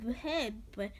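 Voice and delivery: ordinary delivery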